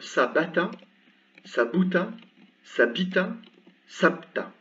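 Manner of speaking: sabata, sabuta, sabita then sabta, each middle vowel set apart; vowel contrast demo. Shown as a demonstration. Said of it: "vous voyez la différence". (sabata, sabuta, sabita, sabta bien distingués)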